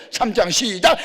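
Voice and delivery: loud, high cue to start; prayer leader's call. Acted. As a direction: call the congregation in loudly. Leading congregation into shouted prayer. (크고 높은 구령)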